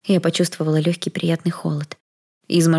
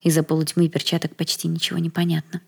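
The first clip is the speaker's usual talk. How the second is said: ordinary delivery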